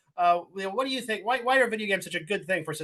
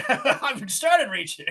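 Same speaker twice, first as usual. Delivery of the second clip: slow, higher, through laughter; laughing; 6 semitones higher, much slower than usual. Real, not acted. Joking back at the friend who accused him of reaching, laughing. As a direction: laugh your way through the comeback.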